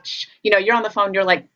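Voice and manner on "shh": imitated sharp "shh"; shushing. Owner to barking dogs while on the phone, mock shushing. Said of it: "and it's like".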